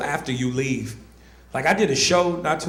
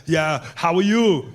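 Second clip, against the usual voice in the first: Arnold impression; slower than usual. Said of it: "He was just like".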